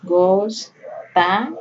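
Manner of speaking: "Gostava" broken very slowly as gos, ta, va; teacher sounding out "Gostava" syllable by syllable; slow, paused reading. Shown as a demonstration. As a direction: leave a long gap between the syllables of "Gostava". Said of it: "Lê ela de forma pausada, devagar".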